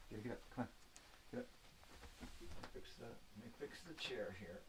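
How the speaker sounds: soft near-whisper while fixing the chair; whispering to himself. Real, not acted. Talking to himself while fixing the chair, near whisper. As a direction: murmur it under your breath.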